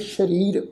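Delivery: ਸਰੀਰ said "shareer", starting with sh; sh sound. Shown as a demonstration. Teacher adds the dot under sassa, ਸਰੀਰ with sh. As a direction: open ਸਰੀਰ on "sh" instead of "s". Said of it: "ਸੱਸੇ ਪੈਰ ਬਿੰਦੀ ਲਗਾ ਕੇ".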